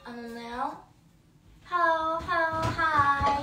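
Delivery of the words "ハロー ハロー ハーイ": louder, drawn-out cheery hello; stretched, happy hello to a viewer joining the live; excited hello. Real, not acted. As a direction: call out a bright sing-song greeting.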